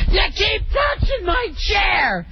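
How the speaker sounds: put-on high shouted outburst at small kids; retold scream. Soldier yelling at little kids, high angry shout. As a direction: bellow it down at the children. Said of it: "screams at these little kids".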